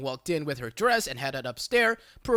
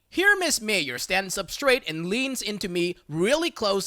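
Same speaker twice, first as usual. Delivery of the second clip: ordinary talk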